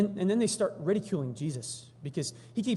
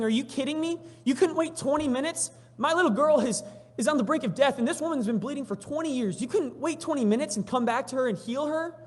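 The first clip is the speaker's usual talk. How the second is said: high-pitched, indignant outburst; acted distraught father pleading in protest; upset yelling; 9 semitones higher, 5 dB louder than usual. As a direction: raise the pitch sharply in exasperated disbelief. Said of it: "starts yelling at Jesus".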